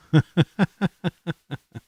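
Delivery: a burst of high real laughter. Real, not acted. Retelling the accent compliment, he bursts out laughing. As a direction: laugh out loud, high and free. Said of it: "see, I'm laughing".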